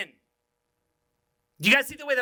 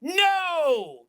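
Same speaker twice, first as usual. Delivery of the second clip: high drawn-out "no", gliding down; playful exaggerated tone; 8 semitones higher, 3 dB louder than usual. Shown as a demonstration. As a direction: call it out high and teasing. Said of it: "it's just in your tone".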